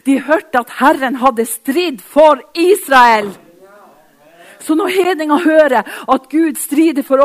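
full-force Pentecostal preaching. Acted. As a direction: preach it out, swelling to the climax.

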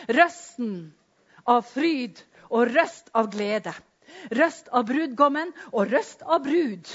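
loud, high, driving sermon voice; fiery preaching. Acted. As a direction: preach it out at full force. Preaching with scripture, building. (forkynnende preken med full kraft)

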